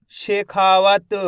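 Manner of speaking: Shekhawat stretched out slowly as one word; slow blended reading of Shekhawat. Shown as a demonstration. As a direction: draw out Shekhawat slowly, joining the syllables. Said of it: "एक एक अक्षर का उच्चारण करके".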